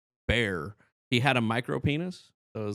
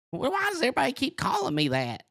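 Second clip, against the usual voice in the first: very high, whiny boyish imitation; Turkey Feather. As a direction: complain in a squeaky cartoon kid voice. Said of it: "looking and sounding like Bobby Hill".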